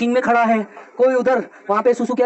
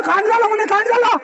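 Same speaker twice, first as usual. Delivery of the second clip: gleeful announcement; 10 semitones higher than usual. Retold or imitated. Friend gleefully announcing the scandal.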